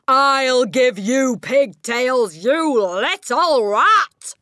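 very slow, loud, high roar; raging yell. Acted. Headmistress roars while swinging the girl by her pigtails. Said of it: "the Trunchbull was yelling".

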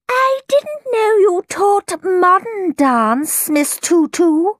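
very high, slow, drawn-out mother's voice; haughty mother. A narrator's reading. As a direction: draw it out high as a disapproving mother. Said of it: "said Violet's mother".

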